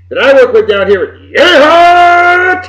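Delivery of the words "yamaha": long, high, loud yee-haw holler; cowboy whoop. Acted. Co-host naming his colleague's Texas home, whooping.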